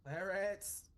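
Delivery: loud, high-pitched call. Acted. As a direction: shout the companion's name to rouse them. Calling to injured companion as healing.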